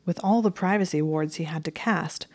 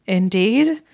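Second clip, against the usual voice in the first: disbelieving; 3 semitones higher, 8 dB louder than usual. A narrator's reading. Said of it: "unconcealed expression of disbelief".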